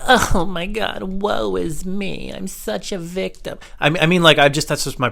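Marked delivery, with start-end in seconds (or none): 0.1-3.6 high, drawn-out whiny victim voice; mock the poor-me martyr, slowly; mock self-pity. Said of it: "this is not like".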